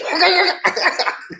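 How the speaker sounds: high cackling laugh. Real, not acted. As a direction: burst into a high giggle. Laughs at himself.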